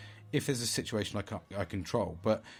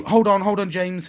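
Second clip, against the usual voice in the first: loud, higher "hold on"; calling himself back; 9 semitones higher, 11 dB louder than usual. Retold or imitated. Calming himself after road rage, loud urgent call. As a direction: shout to stop yourself. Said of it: "but then I'll go".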